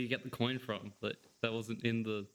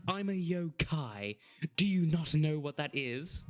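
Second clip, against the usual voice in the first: mock offended Whisper; 5 semitones higher than usual. Voicing Whisper reading dialogue.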